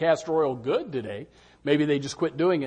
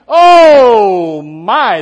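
mock alarmed cry; 6 semitones higher, 17 dB louder than usual. Seeing a valley on the map.

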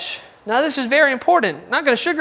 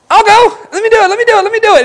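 put-on high, loud, eager child's voice; eager kids volunteering; 10 semitones higher, 9 dB louder, faster than usual. Parent asks for help, kids shout eager volunteering. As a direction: kids jumping at the chance.

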